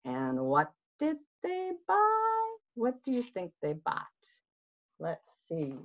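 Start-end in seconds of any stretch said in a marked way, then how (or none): none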